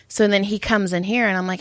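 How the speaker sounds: ordinary delivery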